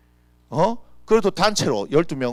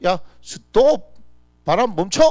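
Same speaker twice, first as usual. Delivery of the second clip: a louder, slowly barked order to the wind; commanding shout; 4 semitones higher, 6 dB louder, slower than usual. Acted. 폭풍을 멈추려는 예수님이 바람에게 크게 외치는 명령. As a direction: stop the storm with a loud slow command.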